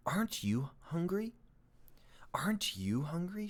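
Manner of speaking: breathy spider whisper, leaning in. Acted. Spider leaning toward frightened James, sinister whisper. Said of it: "she asked suddenly".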